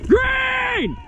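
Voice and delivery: long high yell across the field; shouted callout. Real, not acted. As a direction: bellow it to the team. Calling out in a firefight.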